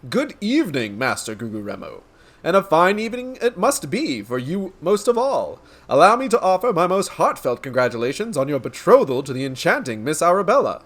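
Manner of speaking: grandiose detective declaiming to an audience; theatrical, florid gentleman sleuth; pompous inspector. Acted. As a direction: proclaim it like a self-important hero. Inspector greets noble.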